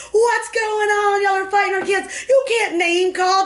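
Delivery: high, slow mock shout; parents shouting. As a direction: yell like a parent storming over.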